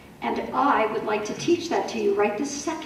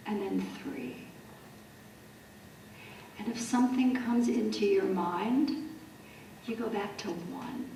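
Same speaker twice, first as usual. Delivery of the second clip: soft, unhurried meditation voice with long pauses; hushed, calming breath-count, fading toward a near whisper; guided meditation; 9 dB quieter than usual. Shown as a demonstration.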